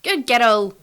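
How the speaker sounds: loud call of praise to a dog; shouting to dog. Retold or imitated. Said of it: "I shout at Bella".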